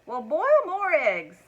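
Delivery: slow, high, loud roar of an impatient husband. A narrator's reading. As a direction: roar each word out, dripping with egg. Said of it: "roared Mister Wagon Wheel".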